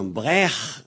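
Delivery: "Brech" with rasped kh ending; "Brech" as brekh; guttural final. Shown as a demonstration. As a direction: say "Brech" scraping the throat at the end. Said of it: "c'est un re".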